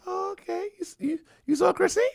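very high, quick sweet trap question; suspicious girlfriend. Retold or imitated. Girlfriend trapping her man about the cousin, high and sweet. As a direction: bait him in a high sugary voice.

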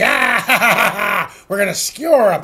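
hammed-up pirate cackle and growl; pirate laugh. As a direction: ham it up like a gloating pirate. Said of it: "I'm channeling my inner pirate".

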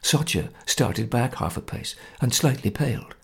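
usual speaking voice

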